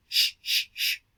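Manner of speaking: a long breathy unvoiced sh, repeated; hissing sh sound. Shown as a demonstration. Teacher demonstrating a sibilant to the class, hissed sh. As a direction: hiss out sh, sh, sh with no voice. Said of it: "teacher demonstrates a sibilant sound".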